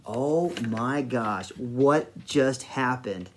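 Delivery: slow, low, dazed disbelief; stunned. Retold or imitated. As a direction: drag it out quietly, still processing. Retold stunned thought after kiss.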